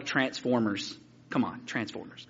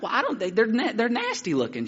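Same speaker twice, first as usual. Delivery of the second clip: higher-pitched, stammered excuse; defensive kid; 7 semitones higher than usual. Retold or imitated. Boy answering his scolding grandmother, defensive. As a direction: excuse yourself like a caught child.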